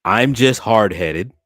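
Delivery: normal talking voice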